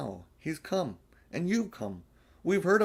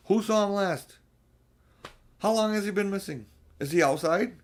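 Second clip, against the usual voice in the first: higher, louder, hurried worried questions; anxious questions; 7 semitones higher, 4 dB louder than usual. Acted. Children panic asking where Edmund went, alarmed and hurried. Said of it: "everyone began asking".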